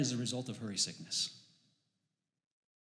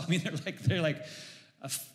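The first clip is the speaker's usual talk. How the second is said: ordinary delivery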